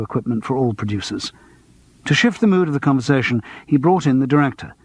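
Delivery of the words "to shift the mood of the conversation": usual speaking voice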